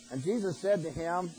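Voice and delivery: ordinary talk, nothing marked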